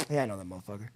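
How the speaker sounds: low, soft, resentful reply; begrudging aside. Retold or imitated. Newcomer answers hippie about friend who passed him, begrudging. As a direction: grumble it quietly, nursing a resentment.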